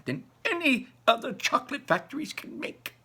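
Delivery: high, eager grandmother voice; a grandma marvelling at the chocolate; old grandmother. Acted. Grandmother telling Charlie about Wonka, high and eager.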